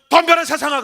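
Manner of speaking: high, loud challenge; defiant battle cry. Retold or imitated. Preacher heading out to face the world, defiant shout. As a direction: call it out boldly in one burst.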